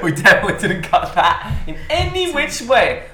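laughing through the words; giggling, high and loud. Real, not acted.

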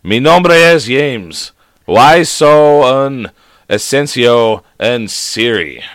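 very slow, deliberate mock Spanish accent; put-on Spanish. Shown as a demonstration. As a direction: stretch each Spanish-accented word out with care. Trying Spanish aloud to phone assistant.

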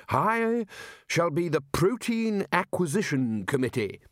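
high, slow, self-important announcement; Ridcully, grand declaration. Acted. Ridcully appoints himself to go fishing, grandly. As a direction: announce it grandly, stretching the first word.

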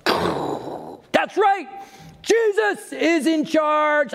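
hammed-up victory yell; mock triumphant shout. Preacher mocking his old self, shouting triumphantly. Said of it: "how I would respond".

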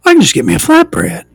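high, fast mock-excited voice, dropping down; mock delight. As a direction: gush like a fake foodie. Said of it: "So I was like".

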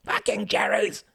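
high, slow, strained battle cry. Acted. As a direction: draw out a thin, high scream. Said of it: "Harold cried out again".

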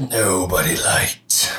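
slow whispered echo of the words; mock whisper. Echoing his friend's words back in a slow whisper. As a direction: hiss the echo back slowly.

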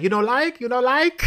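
high-pitched, questioning masseuse voice; high comic impression of the masseuse; imitating the masseuse. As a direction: put on a high voice, asking in mock surprise. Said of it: "she was like".

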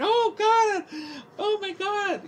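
high, wailing imitation of a terrified cousin; mock crying. Cousin hiding in the back seat, mock terrified wail. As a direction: whimper like you're hiding from bullets. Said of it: "he's, like, crying".

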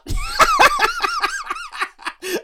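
high, wheezing belly laugh; long burst of real laughter; cracking up. Real, not acted. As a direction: laugh helplessly at your own impression. Cracks up at own bit.

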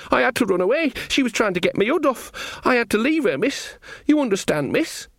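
put-on high-pitched panicky young soldier voice; frightened Sean Ogg. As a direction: blurt it out high, reporting to the queen. Narrator voices frightened Sean in a story.

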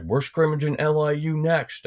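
high-pitched imitation of a coach; mock coach voice, high and sustained; coach's announcement. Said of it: "our coach was like".

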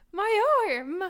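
gasp, then high loud wail; hammed-up agonised shriek; acted pain cry. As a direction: cry out over a stabbed arm. Player acts being stabbed in arm.

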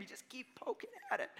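very high, soft, laughing voice; playful squeaky laughing tease; gleeful provoking. Acted. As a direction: giggle through it like a relentless pest.

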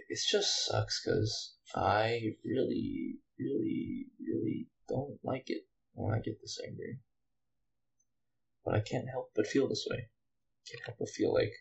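soft, dropping away; quiet, deflated confession; subdued regret. Real, not acted. Regretting getting this angry.

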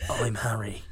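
breathy seductive whisper; hot whisper. Acted. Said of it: "he hotly whispered".

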